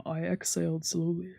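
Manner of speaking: usual speaking voice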